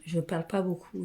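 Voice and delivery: ordinary delivery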